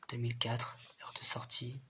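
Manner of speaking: soft unvoiced whisper. Real, not acted. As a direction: read it under your breath. Reading files in the dark.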